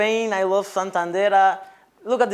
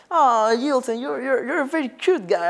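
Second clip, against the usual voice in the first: lightly voiced admirer, pitched up; imitated sweet-talk in a higher voice; mock cooing; 4 semitones higher than usual. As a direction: coo it like a fond compliment. Imitating people in Spain cooing.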